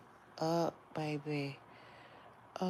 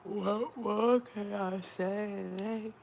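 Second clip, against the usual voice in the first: slow, loud, higher rhetorical question; drawn-out flourish; 4 semitones higher, 6 dB louder, much slower than usual. Acted. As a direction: stretch it out for effect.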